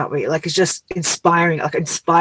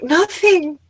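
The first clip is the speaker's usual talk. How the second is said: a higher, tearful-sounding nothing; mock teary nothing; 12 semitones higher than usual. Retelling her partner's question, mock teary reply. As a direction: play your weepy younger self saying nothing. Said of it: "And I was like".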